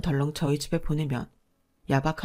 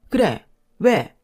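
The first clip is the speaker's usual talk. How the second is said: louder sharp snap of a sister-in-law; defiant retort; 6 dB louder, much slower than usual. Acted. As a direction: throw it back at her, louder. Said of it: "삿대질을 하며 이러더군요".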